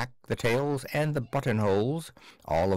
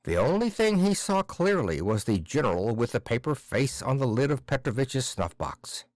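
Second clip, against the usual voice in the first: plain reading voice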